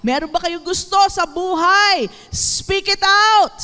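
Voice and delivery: loud, high preacher's call, stretched at the end; rousing exhortation. Real, not acted. Preacher urges the congregation to speak out, loud rousing call.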